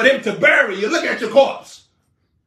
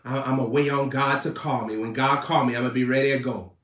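much lower, softer mumbling excuse; mock lazy believer; 11 semitones lower, 6 dB quieter, faster than usual. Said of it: "Up there twiddling in your fingers".

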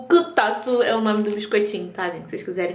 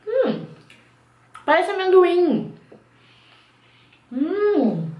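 talking while chewing snack; muffled with food in mouth; mouth full chewing; 7 semitones higher, much slower than usual. Real, not acted. Seen 2: Bites snack at -7, cheeks full and chewing throughout the words.

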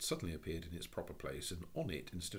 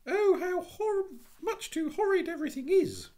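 high, wailing tearful cry; a frightened little girl bursting into tears; 13 dB louder than usual. A narrator's reading. Jane bursting into tears over the cow, wailing high. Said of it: "she cried".

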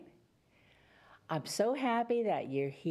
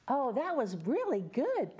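ordinary delivery, nothing marked

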